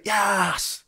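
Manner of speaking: a sudden high, happy "yes"; excited cheer. Real, not acted. Celebrating getting it right.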